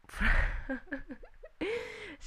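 high burst of laughter at her own slip; embarrassed laugh. Real, not acted. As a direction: laugh it off after fumbling.

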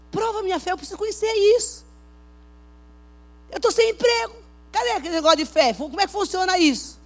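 súplica gritada e aguda a Deus; cobrança exaltada. Acted. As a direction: exija de Deus uma resposta, em alta voz. Encena cobrança desesperada a Deus. (clamor indignado encenado)

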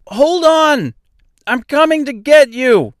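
high, loud, carrying shout; calling over roaring water. A narrator's reading. Dorothy to Mister across the rushing water, shouting high. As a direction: holler across a rushing river. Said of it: "her voice carrying over the loud roar".

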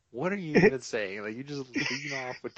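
high, loud disbelieving cry; incredulous outburst; baffled. Retold or imitated. Answering Troy's carpenters remark, loud baffled disbelief. Reacting to coworker's prostitutes remark.

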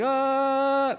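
long, high held "uhhh" like an alarm; imitated phone alert. Preacher showing the congregation the Amber Alert, imitated alarm drone. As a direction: mimic a jarring alert tone with the voice. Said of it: "Like the Amber Alert thing on our phone".

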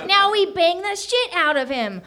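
high, gleeful little alien; delighted high-pitched creature; excited aliens. A narrator's reading.

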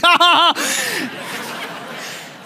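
high-pitched mock cackle; imitated laughing. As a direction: mimic a kid's helpless laughter. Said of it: "So she's laughing".

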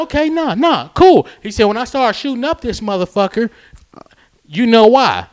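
high, loud retold threat; threatening customer. Customer threatens while leaving, acted out.

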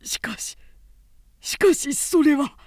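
high, sudden startled protest. A narrator's reading. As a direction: blurt out a refusal in shock. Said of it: "拒もうとするのを抑えて". (驚いて拒むように、高い声で)

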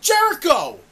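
yelling at Jericho through the screen, outraged; furious shout. Retold or imitated. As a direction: scream at the TV like he ruined the show. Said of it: "and I'm like".

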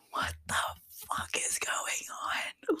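slow, disbelieving whisper; appalled whisper. Retold or imitated. Appalled reaction to the plot.

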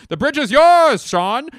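very high, loud handover yell; hammed-up captain screaming across the bridge; frantic movie impression. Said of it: "here's my impression of".

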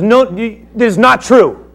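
high and loud, fading to calmer at the end; Paul insisting to Festus his words are true; firm loud rebuttal. Acted. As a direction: argue back hard, then settle. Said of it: "he says to Festus".

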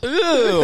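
high startled ooh, played up; mock cut-off ooh. Mimicking getting interrupted by a friend, high mock ooh. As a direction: yelp like being cut off mid-sentence. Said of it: "and I'm like".

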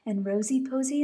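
usual speaking voice

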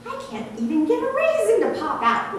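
high, sheepish branch voice; confessing grape branch. Acted. Another branch confesses to the farmer, high and sheepish. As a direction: own up like a small embarrassed plant. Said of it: "one confessed".

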